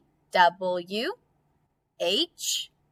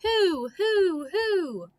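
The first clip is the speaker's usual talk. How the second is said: high owl-like who, who, who; owl hoot reminder; 10 semitones higher, much slower than usual. Pretend. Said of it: "Remember, who".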